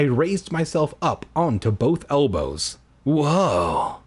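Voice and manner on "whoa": Keanu-esque whoa. Acted. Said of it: "in a Keanu-esque tone".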